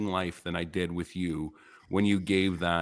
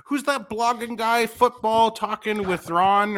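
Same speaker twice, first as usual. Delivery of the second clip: much higher, slow mocking voice; mock co-host voice; 12 semitones higher, slower than usual. Teasing the guest by imitating the co-host who never knew his name, high and slow. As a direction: drag it out high like a clueless guy.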